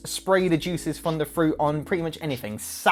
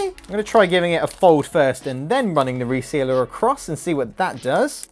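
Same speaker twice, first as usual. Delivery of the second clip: normal talking voice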